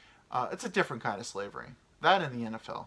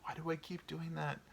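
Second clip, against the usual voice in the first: soft unvoiced self-scold; scolding himself under his breath; whisper; 4 semitones higher, 9 dB quieter than usual. Real, not acted. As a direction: whisper it in embarrassment. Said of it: "whispered self scold".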